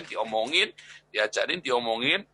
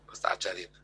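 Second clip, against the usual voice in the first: whispered aside; 7 semitones lower, 6 dB quieter, faster than usual. Real, not acted. Aside while telling story.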